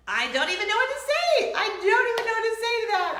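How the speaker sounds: high, loud, incredulous outburst; exasperated disbelief. Real, not acted. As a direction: cry it out high, helplessly amused. Laughing disbelief at husband's remark.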